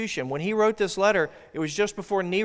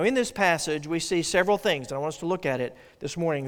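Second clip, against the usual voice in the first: ordinary delivery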